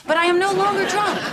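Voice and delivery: loud, high-pitched insistence; indignant. Acted. Lisa rebutting the charge of being drunk, indignant.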